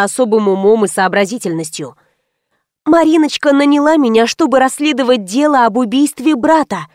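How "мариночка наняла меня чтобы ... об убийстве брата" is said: ordinary delivery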